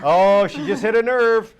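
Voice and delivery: high, drawn-out shout of mock shock; loud teasing reaction. Real, not acted. Co-host reacting to Jamie's jab, loud teasing outburst. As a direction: crow it loudly at the jab.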